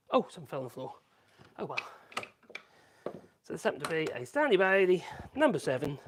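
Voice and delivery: ordinary delivery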